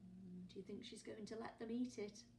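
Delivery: usual speaking voice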